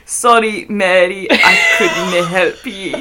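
drawn-out mock-Scottish ending, laughing; bad Scottish accent. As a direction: attempt a Scots lament and crack up. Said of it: "genuinely the worst Scottish accent".

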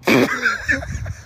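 high burst of laughing; real laughter. Real, not acted. Host laughs at the viral golf video. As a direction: laugh out at the clip.

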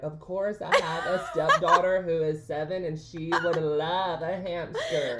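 high, loud, gushing mock niceness; Jessie. Host teasing co-host for being too nice, exaggerated sweet acceptance. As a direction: imitate an overly nice friend, stretching the end. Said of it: "she's so nice".

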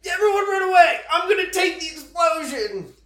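high heroic shout. Retold or imitated. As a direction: shout it like a hero. Steve heroically shouting in story.

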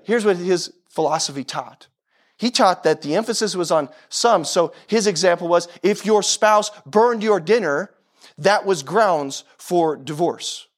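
usual speaking voice

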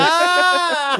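excited. Real, not acted. Guessing word, excited exclaim.

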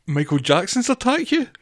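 high, disbelieving question; high incredulous laugh-question. Real, not acted. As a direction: ask it in amused disbelief.

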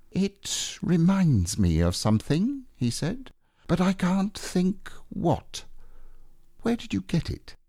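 trying to remember something; Pooh voice, puzzled. Acted.

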